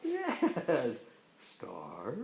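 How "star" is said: "Star" low and soft, rising at the end; questioning rise. Pretend. Parent prompting a child with "Star", soft rising tune. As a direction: lift "Star" gently from a low start. Word game, alternating star and turtle.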